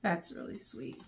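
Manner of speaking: soft, low, moved; touched. Real, not acted. Reading the author's note, softly touched. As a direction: say it quietly, genuinely touched.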